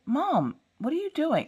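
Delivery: put-on high-pitched kid pleading; exasperated child. Daughter questioning mom's antics, high exasperation. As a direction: imitate a child at a loss with mom.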